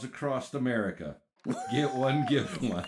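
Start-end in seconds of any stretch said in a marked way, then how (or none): 1.7-2.9 slogan pitch. Acted.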